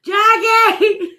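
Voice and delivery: high loud laughing shout of the name. Real, not acted. Calling out to Jackie while laughing, loud shout. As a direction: yell the name through laughter.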